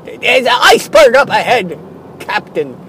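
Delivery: urgent lookout calling to the captain; crew alarm. Acted. Sailor shouting a warning to his captain, alarmed. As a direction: sound the iceberg warning.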